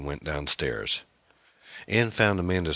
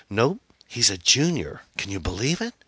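excited daughter; 7 semitones higher than usual. A narrator's reading. Daughter boasts about her date.

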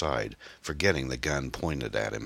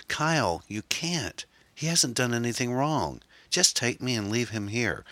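high, frightened woman's voice; high-pitched, helpless protest; desperate plea; 9 semitones higher than usual. Acted. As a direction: plead for his life in a high voice. Erin begs for Tom's life.

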